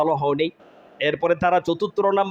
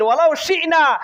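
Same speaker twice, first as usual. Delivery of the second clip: high, punched quote of the verse; emphatic preaching; 10 semitones higher, 4 dB louder than usual. Acted. Preacher quotes God's reply to explain it, emphatic spoken declaiming. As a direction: declaim it quickly and forcefully.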